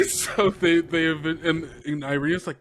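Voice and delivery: normal talking voice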